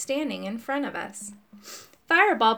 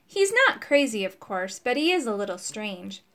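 ordinary delivery, nothing marked

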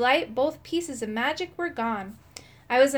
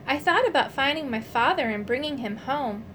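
plain reading voice

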